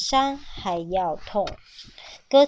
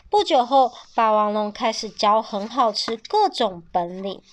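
ordinary delivery, nothing marked

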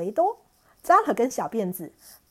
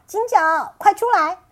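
loud high call; Monkey King calling the demon out, high and loud; 9 semitones higher, 10 dB louder than usual. Acted. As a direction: yell for him to come out. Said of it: "又叫".

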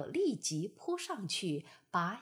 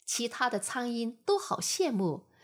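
plain reading voice

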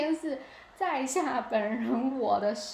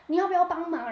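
eager offer of help; 7 semitones higher, 7 dB louder than usual. Retold or imitated. Stranger offers help, quoted.

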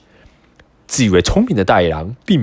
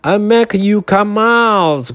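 angry growl; 9 semitones higher than usual. Acted. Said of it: "growled the wolf angrily".

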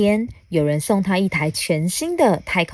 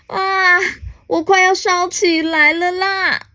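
high, crying storybook voice; panicked wail; 11 semitones higher, 3 dB louder than usual. Acted. Alien running with burning rash, panicked wail. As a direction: wail in acted alarm as if on fire.

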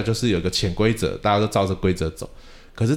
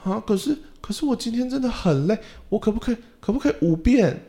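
put-on much higher, whining kid voice; whiny tired child; 13 semitones higher than usual. Tired student whines to the teacher for fewer rounds, much higher whiny kid voice. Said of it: "他就说".

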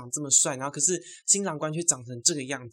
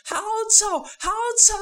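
very high, loud squeal of disbelief; horrified shock; 5 dB louder than usual. Retold or imitated. Reacting to how different the photos were, shocked high squeal. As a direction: squeal it out in mock horror. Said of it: "我吓到快不行".

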